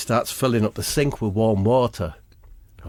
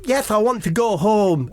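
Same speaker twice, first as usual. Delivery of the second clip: mock scared; 9 semitones higher than usual. Scared kid answers.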